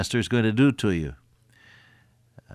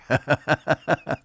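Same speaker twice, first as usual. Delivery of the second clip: amused chuckle; light laugh at the story; 4 semitones higher than usual. Real, not acted. Laughs after the anecdote.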